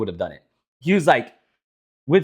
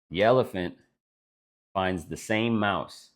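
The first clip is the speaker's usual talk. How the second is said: ordinary talk, nothing marked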